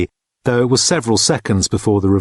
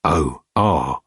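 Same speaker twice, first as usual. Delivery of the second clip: wary; 5 semitones lower than usual. A narrator's reading. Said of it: "said Dirk warily".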